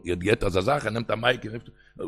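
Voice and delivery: ordinary talk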